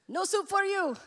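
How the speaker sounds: loud, high-pitched mock-accented shout; Soup Nazi impression. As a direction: shout it like a cartoonishly strict foreign soup seller. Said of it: "the soup guy".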